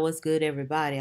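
low, put-on deep greeting; mock deep announcer voice. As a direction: drop the voice down low. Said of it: "I gotta put my deep voice on".

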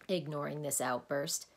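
usual speaking voice

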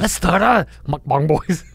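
slow, drawn-out mock Korean accent; put-on accent. Proposing a band name to his co-host in a joking mock Korean accent. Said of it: "Did you just do an accent?".

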